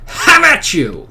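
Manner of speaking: high, loud, slow hammed war cry; mock battle cry. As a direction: bellow it like a duel challenge.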